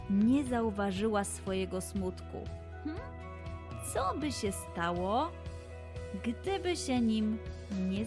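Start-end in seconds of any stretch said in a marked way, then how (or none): none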